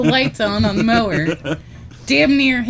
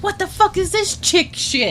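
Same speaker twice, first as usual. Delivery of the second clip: high-pitched indignant husband; hammed-up disgusted disbelief; mock outrage; 10 semitones higher than usual. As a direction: balk at the drink you were handed. Husband disgusted by wife's drink.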